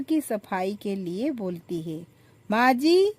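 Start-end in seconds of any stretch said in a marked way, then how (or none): 2.5-3.1 high, loud shouted call across the house. Acted. Elder daughter-in-law shouting for mother-in-law, loud high call. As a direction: yell for her mother-in-law. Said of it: "जोर-जोर से चिल्लाकर बुलाकर".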